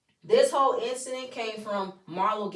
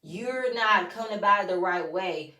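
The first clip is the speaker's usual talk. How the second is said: ordinary talk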